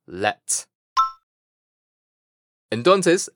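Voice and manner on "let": "Let" alone, low and softer; short English "Let" in place of Spanish late; second-half syllable demo. Shown as a demonstration. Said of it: "lo vamos a pronunciar así". (sílaba "Let" inglesa, grave y suave)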